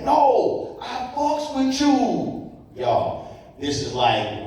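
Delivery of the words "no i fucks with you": loud, high, emphatic; loud public declaration. Retold or imitated. Fan in a casino loudly declaring his support, high and loud. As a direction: announce it for everybody to hear. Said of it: "real loud, real public".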